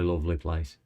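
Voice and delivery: ordinary delivery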